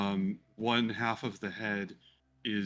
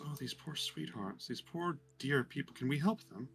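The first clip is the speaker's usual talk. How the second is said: soft pitying Florius; 4 semitones higher, 6 dB quieter than usual. Acted. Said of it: "Florius just goes".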